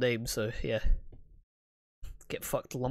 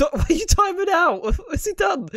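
high laughing disbelief, much higher and louder; cracking up while asking; 8 dB louder than usual. Real, not acted. Laughing at friend's timeout.

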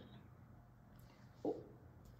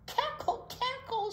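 high cackling hen clucks; hammed-up hen cackle; clucking hen. A narrator's reading. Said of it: "she clucked and laughed".

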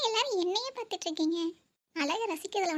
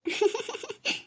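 tearful laugh; 4 semitones higher than usual. Acted. Laughs amid crying reunion.

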